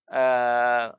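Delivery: ordinary talk, nothing marked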